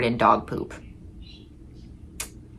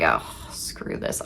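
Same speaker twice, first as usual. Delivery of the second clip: fed-up whisper; 14 semitones lower, 11 dB quieter, slower than usual. Retold or imitated. Exhausted, giving up.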